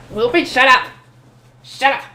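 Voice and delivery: frantic hushing of a companion; urgent silent cry. Acted. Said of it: "My silent cry for him to shut up".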